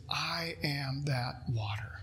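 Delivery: hushed, slow whisper of Jesus' words; a reverent whispered declaration. Acted. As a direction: breathe it out, barely voiced, slowly. Quoting Jesus at climax.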